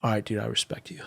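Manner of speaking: quiet, spent concession; imitated exhaustion. Exhausted fighter conceding to his friend, imitated quiet and spent. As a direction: say it softly, as if worn out. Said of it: "we're both, like, really tired".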